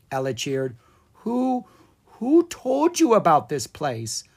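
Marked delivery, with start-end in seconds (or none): 1.2-4.2 a mild nervous stammer on who, who. Acted. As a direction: ask with a shaky start. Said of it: "Owen asked nervously".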